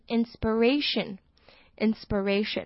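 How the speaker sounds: inspiration said slowly and clearly. Shown as a demonstration. Teacher models inspiration for learners, slowly. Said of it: "这个I呢，都是发呃的音".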